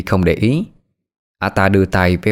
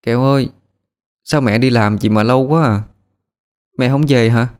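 missing mom; 5 semitones higher than usual. Acted. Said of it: "thằng Cu nhớ mẹ".

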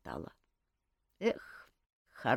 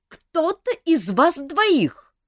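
angry; 14 semitones higher, 8 dB louder, much slower than usual. A narrator's reading. Alisa accuses the others.

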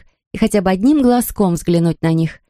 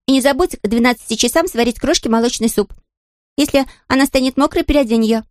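bossy mistress voice; 6 semitones higher than usual. Acted. Mistress voice giving orders.

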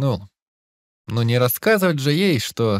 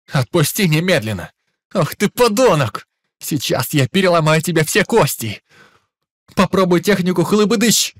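enraged yelling; 4 semitones higher, 4 dB louder than usual. Acted. Young master raging while held.

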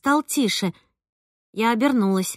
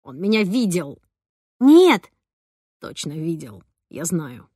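muffled through glass; 5 semitones lower than usual. Acted. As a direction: speak from behind closed glass. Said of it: "Ромкин голос звучал глухо из-за стекла".

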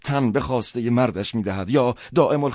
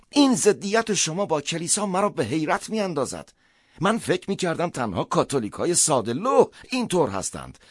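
higher-voiced priest, taken aback; astonished priest; 7 semitones higher than usual. Priest answers Schnier's attack on the church, astonished. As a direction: play the priest in lilting amazement.

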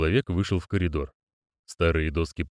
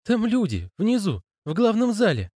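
high timid lad voice; 13 semitones higher than usual. Acted. Stanley's voice, high.

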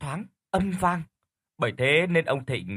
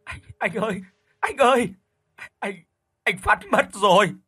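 wailing through sobs, much higher and louder; grieving wife sobbing on the phone; crying; 8 semitones higher, 5 dB louder than usual. Acted. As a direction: break down crying as you tell the news. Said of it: "đã nghe thấy tiếng khóc rồi".

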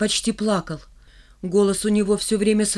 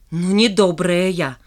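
angry; 4 dB louder, slower than usual. A narrator's reading. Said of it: "зло сказала Шмакова".

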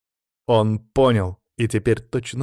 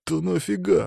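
high, whining plea; pleading; 6 semitones higher than usual. A narrator's reading. Alexei begs Sanya to explain, pleading. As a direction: beg for a reason, pitched up. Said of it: "взмолился Алексей".

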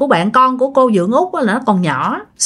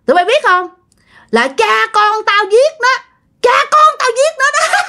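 possessed man's voice; 9 semitones higher than usual. Retold or imitated. Possessed neighbor taunting the mourners, raised gloating voice. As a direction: gloat like a spirit owning the murder. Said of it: "giọng là giọng đàn ông ồn ồn ồn".

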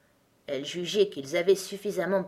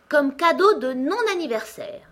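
ordinary delivery, nothing marked

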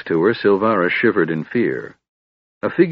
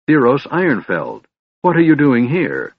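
surprised; 6 semitones higher, 4 dB louder than usual. Acted. Surprised reunion with old friend.

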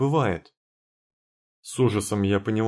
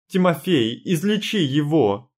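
pleading cry; 7 semitones higher, 4 dB louder than usual. Acted. Heroine begs Timofey, first take.

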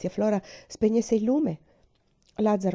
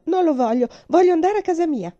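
much higher, louder crying refusal; hysterical refusal; 9 semitones higher, 8 dB louder than usual. Acted. Terrified girl refusing the chocolate mouse, hysterical shout. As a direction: shriek it out in panic.

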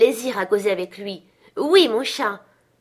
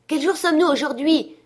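high, raised call from a window; 7 semitones higher, 4 dB louder than usual. A narrator's reading. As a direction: call down to someone in the street. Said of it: "cria Scrooge à sa fenêtre". (question criée de loin, plus aiguë)

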